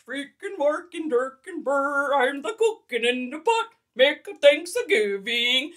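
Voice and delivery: mock Swedish gibberish in a Muppet chef voice, slow in places; bad Swedish Chef. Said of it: "that's my really bad version of, uh, the Swedish Chef".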